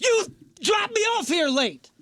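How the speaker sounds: high, drawn-out indignant shout; angry outburst. Retold or imitated. Traveler confronting airline worker about arriving late, angry outburst. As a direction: yell the accusation, stretching it out.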